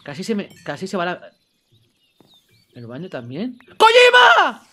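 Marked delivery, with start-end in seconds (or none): 3.8-4.7 high, loud drawn-out cry of a name; alarmed shout. Real, not acted. Gamer calls out for Kojima, alarmed shout. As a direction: yell the name in sudden alarm. Cries out in game panic.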